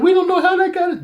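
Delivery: high, fast, laughing mock protest; nervous denial. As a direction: blurt it out, squeaky and guilty. Brothers deny stealing chalice.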